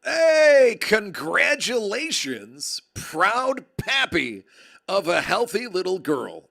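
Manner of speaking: big hammed-up cheer for the new dad; booming congratulations. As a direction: boom it out like a proud announcer.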